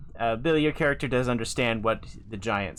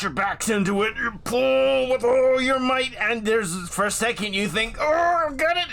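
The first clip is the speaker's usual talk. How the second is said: straining; 11 semitones higher, 6 dB louder than usual. A narrator's reading. Narrating the straining pull.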